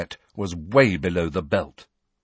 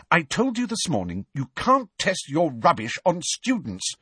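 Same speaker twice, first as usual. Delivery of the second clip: high-pitched telling-off; scolding; 9 semitones higher than usual. A narrator's reading. Hermione scolds the twins over testing on students, cross.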